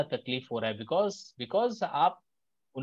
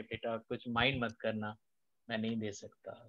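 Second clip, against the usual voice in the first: much softer, low tender no; gentle refusal; 6 semitones lower, 10 dB quieter than usual. Shown as a demonstration. Softly refusing a child, tender and calm. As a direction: refuse the kid softly, with love. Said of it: "एकदम आराम से".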